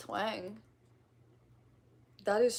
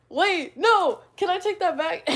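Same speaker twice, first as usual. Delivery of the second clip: loud, high-pitched plea; flustered outcry; 10 semitones higher, 12 dB louder than usual. Real, not acted. Streamer caught out by an accent test, flustered outcry. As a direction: burst out in alarmed protest.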